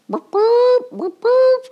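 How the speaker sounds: high, loud chicken boops; clucking chickens. A narrator's reading. Chickens clucking over their eggs. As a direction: cluck like hens laying eggs. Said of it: "went the chickens".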